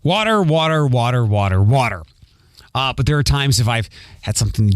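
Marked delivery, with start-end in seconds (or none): 0.0-2.0 "water" repeated in one run, open 'wah' vowel; drilled standard 'water'. Shown as a demonstration. Host flexing his trained muscle, standard "water" repeated. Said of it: "I say".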